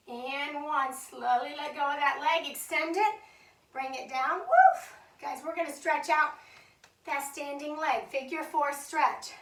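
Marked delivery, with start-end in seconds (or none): 4.4-5.0 high, loud exhaled "woof"; relieved whoop. Real, not acted. Instructor to class after a hard set, high loud whoop.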